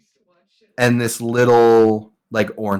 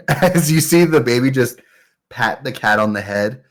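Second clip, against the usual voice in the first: ordinary talk, nothing marked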